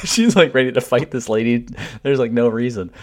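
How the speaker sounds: high, loud, laughing through the words; cracking up while telling it; amused laughing. Real, not acted. Husband recounting his wife ready to fight, laughing.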